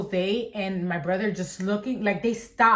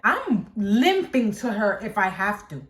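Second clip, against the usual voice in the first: ordinary delivery, nothing marked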